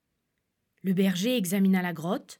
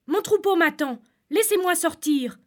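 pleading; 7 semitones higher, 6 dB louder than usual. Acted. Shepherd pleads to be let out.

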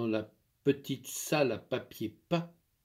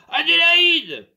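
very loud, high bellow; furious cry; 12 dB louder, slower than usual. Acted. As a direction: shake the house with her name. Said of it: "Un cri furieux faisait trembler la maison".

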